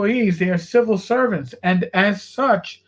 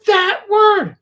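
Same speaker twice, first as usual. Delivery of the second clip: higher, louder mock-scandalized mother; appalled mom; 10 semitones higher, 5 dB louder than usual. Mother scolds her son at the dinner table, higher and louder. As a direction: play the shocked mom pointing it out.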